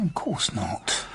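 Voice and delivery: hushed, breathy denial; whispered of course not; shocked whisper. Acted. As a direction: drop to a whisper, appalled. Shocked reply to callous question.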